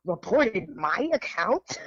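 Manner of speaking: slow, drawn-out retold disbelief; mock incredulous. Reacting to being reported online, mock incredulous. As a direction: stretch it into an incredulous question.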